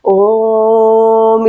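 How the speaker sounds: ओम held long on one steady note; drawn-out vowel. Shown as a demonstration. Teacher showing a long pluta vowel to students, ओम drawn out. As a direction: stretch ओम out like a chant. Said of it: "जैसे हम ओम का उच्चारण करते हैं ना".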